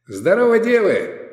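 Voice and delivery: loud, high, jolly greeting; cheery hail. A narrator's reading. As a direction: hail them merrily. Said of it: "весёлый баритон".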